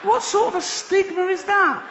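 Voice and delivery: high, loud outraged cry; incredulous shout. Real, not acted. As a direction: shout it out in mock disbelief. Comic outrage at the name.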